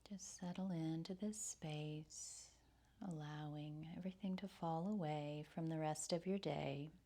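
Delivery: soft, soothing breath-led meditation voice; guided meditation. Real, not acted. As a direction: softly guide them through the breath, with long pauses.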